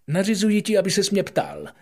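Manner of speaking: loud, high, quick king's command; hasty royal order. Acted. King hastily ordering the prince to ask, loud and high. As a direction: blurt the decree in a hurry. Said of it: "řekl honem".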